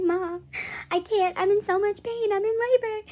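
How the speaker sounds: high, loud wail to mom; mock crying. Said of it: "sitting here crying".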